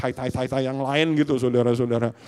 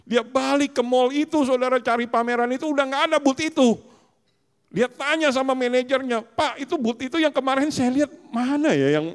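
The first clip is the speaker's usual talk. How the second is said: raised, higher, emphatic preaching; 10 semitones higher, 4 dB louder than usual. Acted. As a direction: drive the point home loudly. Preaching at full force.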